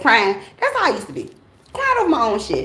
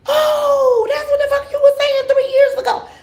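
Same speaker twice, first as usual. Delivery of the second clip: much higher and louder, amazed; stunned realization; 5 dB louder than usual. Retold or imitated. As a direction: shout it out as the meaning finally lands. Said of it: "and be like".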